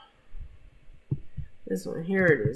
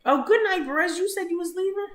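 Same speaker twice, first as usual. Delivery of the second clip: surprised; 13 semitones higher than usual. Real, not acted. Surprised viewer is leaving stream.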